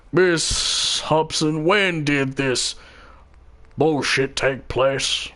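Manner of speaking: slow judge voice. Acted.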